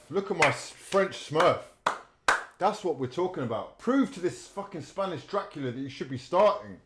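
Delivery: hyped urging. Real, not acted. Hyping the substitute.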